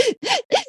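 a high sudden gasp for air; sharp panicked gasp. Retold or imitated. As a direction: gasp like you can't breathe. Retelling panic attack in therapy.

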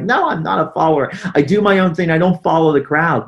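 loud, high mock protest; retold angry yelling. Nine-year-old protesting to his dad, mock yelling. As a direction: yell back like an indignant kid, retold. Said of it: "yelling at him and screaming at him".

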